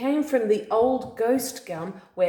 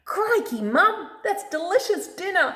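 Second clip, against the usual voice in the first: high, bubbly praise; delighted demo line; 11 semitones higher, 3 dB louder than usual. Shown as a demonstration. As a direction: show the child how to say it with delight. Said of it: "You'll have to say that".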